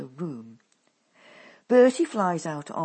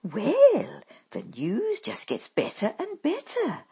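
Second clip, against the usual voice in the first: bright, higher and louder cheerful mother; cheerily; 8 semitones higher, 6 dB louder than usual. A narrator's reading. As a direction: sing it out with false good cheer. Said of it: "she answers cheerily".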